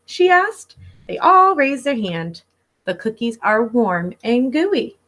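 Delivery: lively picture-book read-aloud; reading to children. Acted. Teacher reading Pete the Cat to her class, storybook voice. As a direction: read it like story time for little kids.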